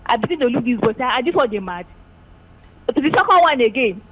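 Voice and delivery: loud caller over a phone line. Real, not acted. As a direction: telephone voice, loud and distant.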